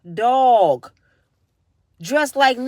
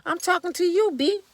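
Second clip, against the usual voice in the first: higher snapping comeback; hallway confrontation; 7 semitones higher than usual. Retold or imitated. Aide confronting the mayor in the hallway, higher and sharp. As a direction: snap back, pitched up. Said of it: "the woman said".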